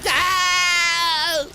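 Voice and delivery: very high, loud whoop; triumphant shout. Real, not acted. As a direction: yell it out in triumph.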